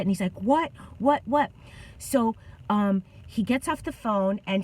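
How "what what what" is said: quick, higher repeated questions, retold; imitating upset disbelief. Coworker taking a bad phone call, retold upset disbelief. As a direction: act out a coworker's startled questioning. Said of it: "He was so upset".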